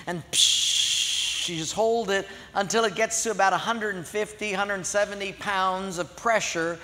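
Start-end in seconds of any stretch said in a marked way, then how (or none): 0.3-1.5 air hiss. Retold or imitated.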